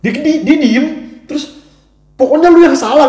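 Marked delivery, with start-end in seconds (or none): 2.1-3.1 high, loud mock insistence; hammed-up stubborn boyfriend; stubborn angry retort. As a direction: throw it back like a sulky boyfriend.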